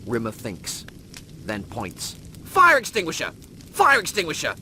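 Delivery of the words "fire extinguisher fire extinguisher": excited exclamation. Acted. Rimmer remembers, points.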